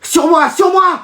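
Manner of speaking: high excited shout for the pass; calling for the ball. Acted. Gamer to teammates, high excited shouting for the pass. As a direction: yell for them to pass.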